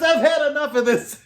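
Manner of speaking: a star who has had enough; fed-up Werner Klemperer. Retold or imitated. Actor fed up with heckler.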